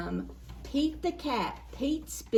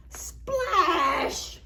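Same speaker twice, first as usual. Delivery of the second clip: exclaiming; 6 semitones higher, 3 dB louder than usual. A narrator's reading. Splash exclamation.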